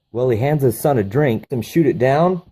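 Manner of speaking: ordinary talk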